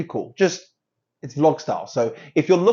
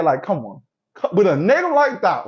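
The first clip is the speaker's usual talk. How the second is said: usual speaking voice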